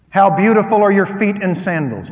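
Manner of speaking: loud, lifted reading of the verse; preaching scripture. Acted. Scripture read aloud with force.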